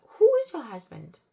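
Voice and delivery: high, loud exasperated shout; shouting at a stranger who keeps accusing her. Retold or imitated. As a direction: yell it down the phone at her. Shouting at the caller.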